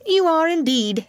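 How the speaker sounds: squeaky rat retort; twitchy squeaking rat voice. Acted. Rat answers.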